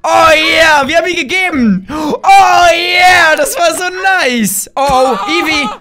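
normal talking voice

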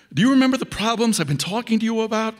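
desperate demand for recognition; agitated pleading. Acted. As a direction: press him hard, almost shouting. Pleading with the president hologram.

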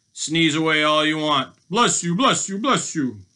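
gloating storybook robber; mocking cry. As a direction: sing out each blessing in a taunt. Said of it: "he cried".

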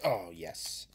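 soft whispered yes; whispered delight. Real, not acted. Seeing a good card, hushed and pleased.

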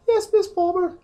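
squeaky falsetto mock officer; mock female voice. Gamer voicing Palmer in a silly falsetto. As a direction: pitch way up, hamming a lady officer.

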